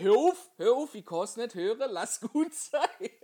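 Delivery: high, loud, laughing protest; laughing plea to stop. Retold or imitated. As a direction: beg him to stop while cracking up. Stoppt Bruders Witz, lachend. (lachend laut flehend)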